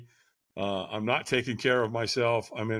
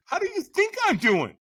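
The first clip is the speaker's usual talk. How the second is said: much higher, louder hammed-up anger; mock furious rant; 12 semitones higher, 5 dB louder than usual. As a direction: snap back at the early caller in mock fury. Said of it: "And I'm going".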